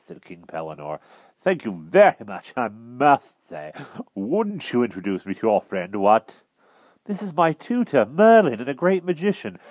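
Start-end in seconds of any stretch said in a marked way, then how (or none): 1.4-6.3 hearty knight, higher and emphatic; affable King Pellinore. A narrator's reading. Knight king asks for an introduction, affable. 7.0-9.6 put-on high, raised boy's voice; eager boy. A narrator's reading. Boy presents his tutor to the king, eager.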